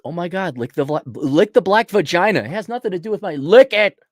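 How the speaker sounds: mock the true believers with overdone delight; mock rapture. Mocking brainwashed employees' praise.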